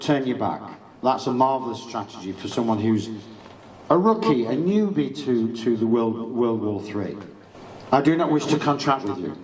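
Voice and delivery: ordinary talk, nothing marked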